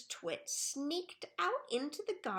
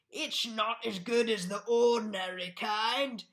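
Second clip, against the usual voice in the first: grumbling through a mouthful; mouth full; 4 dB louder than usual. A narrator's reading. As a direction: talk as if chewing. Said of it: "talking with his mouth full".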